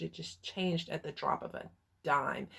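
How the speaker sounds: usual speaking voice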